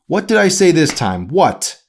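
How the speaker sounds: a fed-up mother pushing back; exasperated. A narrator's reading. Mother demands an answer.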